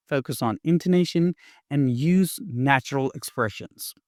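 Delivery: ordinary talk, nothing marked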